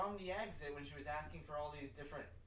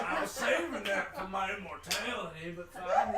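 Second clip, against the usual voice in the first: loud mock lament; 4 semitones higher, 11 dB louder than usual. NPC rant continues loudly.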